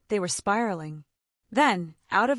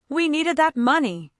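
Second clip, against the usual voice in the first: sharply; 5 semitones higher, 4 dB louder than usual. A narrator's reading. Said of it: "she said sharply".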